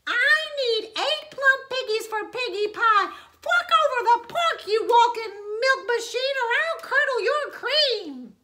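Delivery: high, loud bossy witch voice; demanding witch. A narrator's reading. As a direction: bark orders like a greedy witch. Witch threatening the cow.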